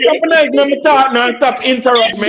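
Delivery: high-pitched exasperated rebuke; angry scolding. Real, not acted. Man scolding the woman talking over him, exasperated. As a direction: cut her off, pitch jumping up.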